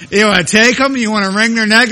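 loud, high-pitched mock outrage; exasperated parent. Parent fed up with a defiant child, loudly exasperated. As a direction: raise the voice like a parent at wits' end.

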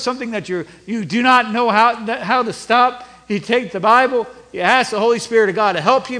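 loud, urgent pulpit exhortation; forceful preaching. Acted. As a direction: preach it hard, punching key words.

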